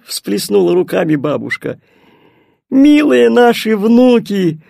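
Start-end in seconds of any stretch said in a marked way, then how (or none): 2.7-4.6 high, louder, drawn-out greeting; joyful exclamation. A narrator's reading. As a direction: exclaim fondly to the grandchildren. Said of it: "воскликнули они оба". (радостное восклицание)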